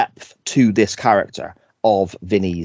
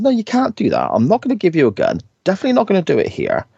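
higher, fast mock henchman refusal; quick pitched-up imitation of a goon; 10 semitones higher, faster than usual. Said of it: "he basically says".

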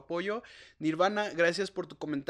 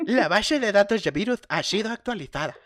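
antivirus voice prompt; 3 semitones higher, 6 dB louder than usual. Retold or imitated.

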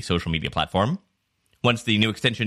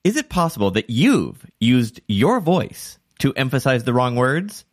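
IT and YOUR punched oddly in an announcer read; over-emphasize small words like "it" and "your"; stress on wrong words; 2 semitones higher, 3 dB louder than usual. Shown as a demonstration. Host demonstrating a bad intro, wrong words stressed. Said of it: "like I've done three times now".

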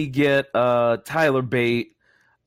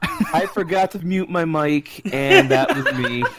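amused, higher-pitched admission; laughing confession; 6 semitones higher, 3 dB louder than usual. Real, not acted. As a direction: own up while laughing. Owning up to the accidental scream.

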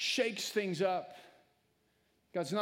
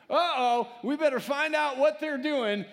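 mock parental alarm; 7 semitones higher, 7 dB louder than usual. Parent notices kids too quiet.

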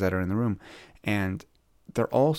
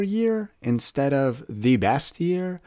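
year stretched out slow and high; stress on year; 6 semitones higher, much slower than usual. Real, not acted. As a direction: lean slowly on "year" to set your year against the best year. Contrasting your year and best year.

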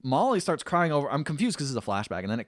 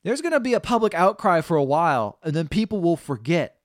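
louder, higher warning; stern threat; 7 semitones higher, 7 dB louder than usual. Retold or imitated. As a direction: press the threat home. King threatening nephew.

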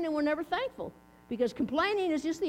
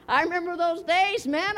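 ordinary talk, nothing marked

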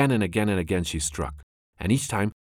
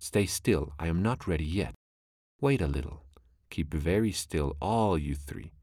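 usual speaking voice

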